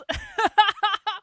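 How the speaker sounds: high burst of laughter; loud laugh. Real, not acted. Laughs at own blame joke.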